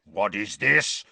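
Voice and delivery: slow, growled demand; angry growl. A narrator's reading. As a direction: growl it slowly, outraged uncle. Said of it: "growled Uncle Vernon".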